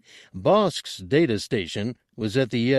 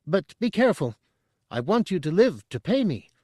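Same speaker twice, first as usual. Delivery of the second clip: high, bubbly little alien voice; burbling Chadrafan; 9 semitones higher than usual. A narrator's reading. Chadrafan burbling to the hunters, high and eager. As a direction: burble it like an eager small creature.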